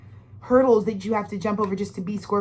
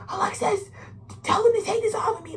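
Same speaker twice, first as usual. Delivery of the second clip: high, strained plea; pleading dad; 11 semitones higher than usual. Retold or imitated. Strapped-down father begging his daughter, high and strained. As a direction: beg her to free you.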